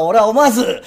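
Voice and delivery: ordinary delivery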